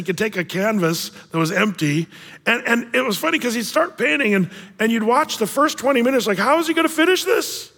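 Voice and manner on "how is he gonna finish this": puzzled wonder. Retold or imitated. Kid puzzled watching painter.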